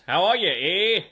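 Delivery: hammed-up Canadian accent with a drawn-out "eh"; bad Canadian accent. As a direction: ham up a terrible Canadian accent. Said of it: "the worst Canadian accent I've ever done".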